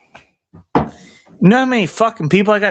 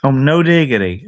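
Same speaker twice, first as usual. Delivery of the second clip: ordinary talk, nothing marked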